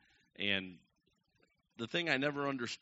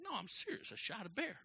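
high-pitched, softer insistence; earnest protest; 10 semitones higher, 4 dB quieter than usual. Retold or imitated. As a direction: plead to be believed in a high voice. Insisting to disbelieving listeners.

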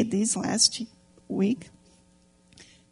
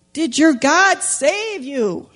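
slow, louder, higher anxious call; worried king calling to Daniel; anxious shout; 5 semitones higher, 4 dB louder, much slower than usual. Acted. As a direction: call out desperately into the den. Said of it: "he shouted anxiously".

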